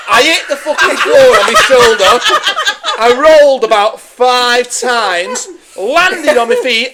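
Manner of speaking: loud, high and drawn out through laughter; laughing punchline. Real, not acted. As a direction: belt out the punchline while cracking up.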